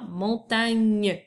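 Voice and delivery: "montagne" drawn out slowly, ending on gn; clear gn sound. Shown as a demonstration. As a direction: stretch "montagne" to show its final gn. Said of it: "G N qui fait le son ñ". (« montagne » lentement, avec le son gn bien marqué)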